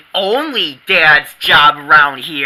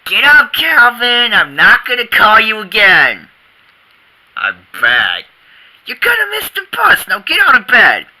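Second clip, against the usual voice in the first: yelling; 7 semitones higher, 6 dB louder than usual. Acted. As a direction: shout up the stairs. Mother yelling upstairs.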